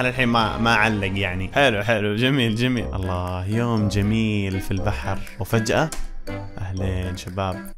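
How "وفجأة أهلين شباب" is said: ordinary talk, nothing marked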